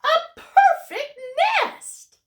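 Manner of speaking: very high, loud thrilled cry; a goose overjoyed at the nest; excited goose. Acted. Goose claims the perfect nest, very high and thrilled. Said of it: "she cried".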